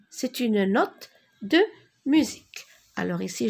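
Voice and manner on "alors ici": normal talking voice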